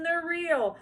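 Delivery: high, loud tearful protest; high crying child. A narrator's reading. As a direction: cry back at her. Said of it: "cried Susan".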